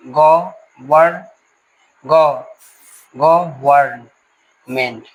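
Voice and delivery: Government drawn out in separate pieces, restarting from ग; syllable by syllable. Shown as a demonstration. Teacher breaking a word apart for students, slow separate syllables. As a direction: sound out government slowly as ग, वर्न, मेंट. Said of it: "इस तरीके से हम लोग शब्द को तोड़ेंगे".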